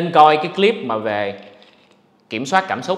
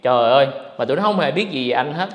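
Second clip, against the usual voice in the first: ordinary talk, nothing marked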